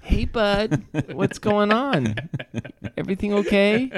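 high, doting mom voice; mocking imitation. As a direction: coo anxiously at her boy. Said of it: "Erica does this thing".

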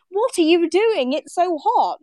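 high, drawn-out retold disbelief; incredulous. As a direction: act out baffled protest at someone's odd choice. Retold disbelief at warmly dressed locals.

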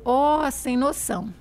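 slow, drawn-out "Ó, sem noção"; relaxed demo of mineiro slang; slow mineiro expression. Shown as a demonstration. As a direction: stretch each word like a mineira. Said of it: "vai dizer".

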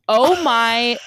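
a contained mock shriek; retold excited scream. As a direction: imitate his scream without fully yelling. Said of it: "And he screamed".

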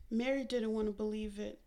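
ordinary delivery

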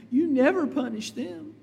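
lightly voiced child grumbling about a sibling; complaining child. Child complains to parents about a favored sibling, mock protest. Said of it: "some of your children say".

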